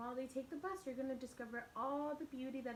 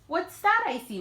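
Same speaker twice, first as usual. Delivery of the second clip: playful bus driver; 7 semitones higher, 19 dB louder than usual. Acted. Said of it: "Mister Dennis asked".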